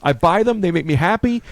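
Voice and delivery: high, loud mock self-defence; retold indignant justification; defensive retort. Acted defence of liking toys.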